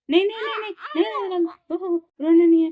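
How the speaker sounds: loud pleading no no no; urgent hushing. Real, not acted. Mother hushing crying baby.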